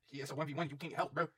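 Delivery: usual speaking voice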